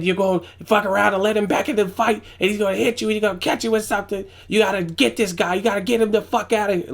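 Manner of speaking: high, urgent imitation of a coach laying into his fighter; yelling cornerman. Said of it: "It's not just about purely yelling".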